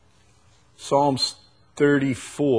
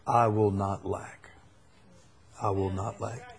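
quiet confession; 9 semitones lower, 12 dB quieter than usual. Acted.

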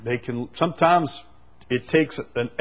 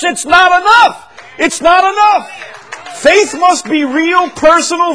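high, loud revival preaching; rising fire-and-brimstone preacher; preaching at full force; 13 semitones higher, 12 dB louder than usual. Real, not acted. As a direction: shout it out to the congregation.

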